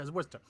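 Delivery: Worcester as "WUSS-tuh", R dropped; local pronunciation. Shown as a demonstration. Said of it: "It's not Worcester".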